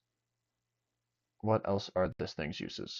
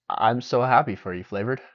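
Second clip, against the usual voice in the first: excited; 3 semitones higher, 6 dB louder than usual. Real, not acted. Cheering chat member's story.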